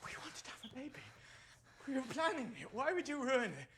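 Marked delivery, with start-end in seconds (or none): 1.9-3.7 higher, louder argument; heated shouting. Acted. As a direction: hurl it back. Shouting match.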